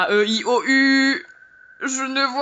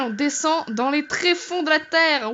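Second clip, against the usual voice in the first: normal talking voice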